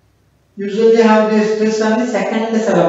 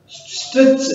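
stretched out; 5 semitones higher than usual. Shown as a demonstration. Teacher illustrates stretching a word.

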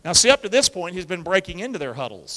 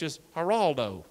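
ordinary talk

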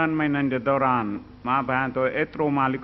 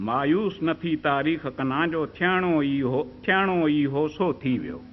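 ghazal recitation; 5 semitones higher than usual. Acted. Poet opening his ghazal for the audience, recited. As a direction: recite each line as poetry, letting it fall at the close.

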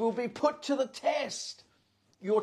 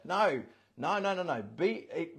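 ordinary talk, nothing marked

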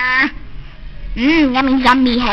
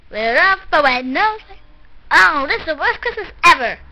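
ordinary delivery

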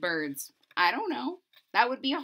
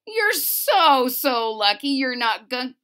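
lively, bouncy read-aloud of rhymes; storybook narration; 8 dB louder than usual. A narrator's reading. Reading a rhyming book to a child, lively narration.

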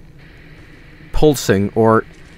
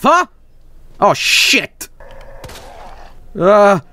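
normal talking voice

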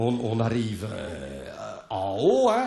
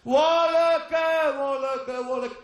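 high calls across the valley; repeated shouts for a lost wife; crying out; 9 semitones higher, much slower than usual. Retold or imitated. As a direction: call into the trees. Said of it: "je crie".

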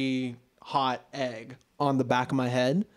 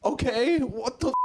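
high-pitched mock bafflement; imitated high, incredulous reaction; baffled annoyance; 8 semitones higher than usual. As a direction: reenact a confused, put-out reply. Acting out his baffled reply.

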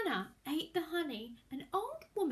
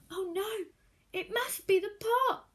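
alarmed; 7 semitones higher, 5 dB louder than usual. A narrator's reading. Hannah realises.

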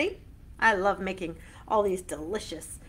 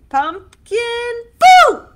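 boo shout; 20 dB louder, much slower than usual. Shown as a demonstration. Halloween boo game.

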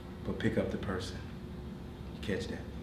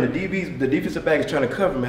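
ordinary talk

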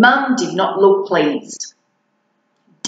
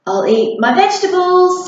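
put-on higher, eager bargaining kid voice; pleading monster child; 9 semitones higher, 4 dB louder than usual. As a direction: offer a deal to escape the plate.

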